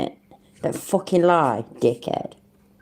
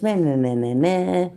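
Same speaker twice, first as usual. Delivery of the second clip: mocking whine. Mocking whining after Chiller's story.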